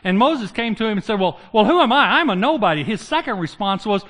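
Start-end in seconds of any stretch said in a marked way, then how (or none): 1.2-2.8 laughing mock excuse. Moses' excuse, mock-whiny, laughing.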